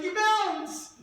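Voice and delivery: retold trampoline cheer; imitated kids' call. Kids urging girls to bounce.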